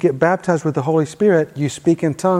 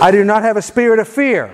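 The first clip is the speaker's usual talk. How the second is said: higher-pitched, emphatic faith declaration; like you mean it; 4 semitones higher than usual. Shown as a demonstration. Preacher leads congregation's repeat-after-me prayer, emphatic and meaning it. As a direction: proclaim it with conviction for others to echo. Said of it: "say it like you mean it".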